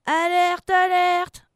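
high, very loud alert; yelled alarm. A narrator's reading. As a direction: yell the warning to the crew. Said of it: "hurle Enzo". (alerte hurlée)

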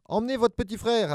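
high, firm mum's order; insisting mother. Acted. Mother making the kids take their brother, insisting. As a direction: insist like a mother who won't budge. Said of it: "insista Madame Monstre".

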